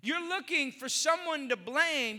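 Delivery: usual speaking voice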